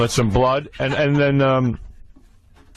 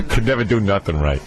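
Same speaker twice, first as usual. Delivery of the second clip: chuckling as you echo the double negative; laughing mock repeat; 6 semitones lower than usual. Host laughing while mocking a cohost's grammar. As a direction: repeat his bad grammar through a laugh. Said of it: "You gotta work on that grammar".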